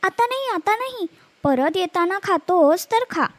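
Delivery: put-on high, teasing old granny putting them off; a lightly voiced old woman brushing off the beasts; old woman fobbing off. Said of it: "म्हातारी प्रत्येक वेळी तेच सांगायची".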